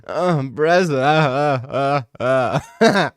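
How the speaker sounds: lightly voiced Dagestani accent, hesitant uh; hesitate, then a Dagestani braza; Islam impression. Streamer performing his best Islam impression, light Dagestani accent. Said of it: "It's my best Islam impression".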